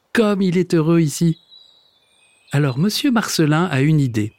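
plain reading voice

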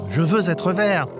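high-pitched crocodile demand; shouting crocodile. Acted. Crocodile demanding a colour, crying out high. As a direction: call it out high and eager. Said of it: "cria le crocodile".

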